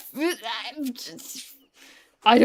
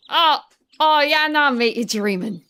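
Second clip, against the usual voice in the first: hammed-up Aussie brush-off; loud, high start easing down in mock Australian; Australian accent; 6 semitones higher, 6 dB louder than usual. Streamer playing an Australian for chat, in a mock Aussie accent. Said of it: "What do the Australians say?".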